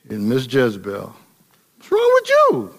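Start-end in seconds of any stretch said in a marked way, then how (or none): 1.8-2.7 very high, pointed wife's voice; Jezebel's question. Retold or imitated. Jezebel asking pouting Ahab what's wrong, very high voice. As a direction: play Jezebel sizing up her pouting husband.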